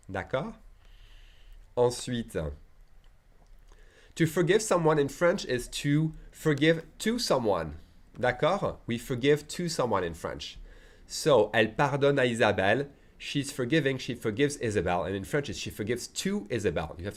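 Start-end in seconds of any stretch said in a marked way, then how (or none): none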